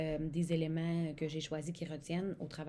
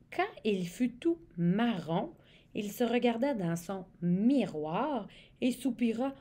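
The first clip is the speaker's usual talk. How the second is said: storybook reading; 5 semitones higher, 5 dB louder than usual. Acted.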